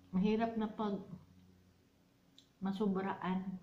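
talking with spoon in mouth; eating between words; mouth full of ice cream. Real, not acted. Seen 0: Spoon with purple ice cream at her lips while talking, repeatedly.

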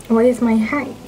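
ordinary talk

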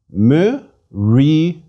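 "Myrios" broken into separate syllables with pauses. Shown as a demonstration. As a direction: sound out "Myrios" slowly, one syllable at a time. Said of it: "as a separate syllable".

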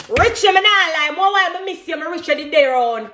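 gushing shoutout. Real, not acted. Shoutout to her bestie.

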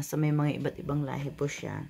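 ordinary delivery

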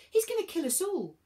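high-pitched alarmed shout; shouting over noise. A narrator's reading. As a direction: cry out over the wind. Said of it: "shouted above the noise of the wind".

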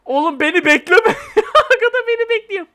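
high, laughing disbelief; amused that another player is waiting. Real, not acted.

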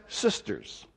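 ordinary talk

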